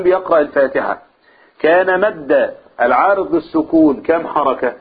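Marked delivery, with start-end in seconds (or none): none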